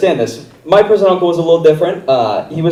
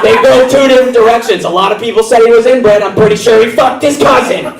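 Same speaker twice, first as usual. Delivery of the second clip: loud, high, manic lecture; meth-fuelled teacher; 9 semitones higher, 4 dB louder than usual. Pretend. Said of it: "my teacher was on meth every day".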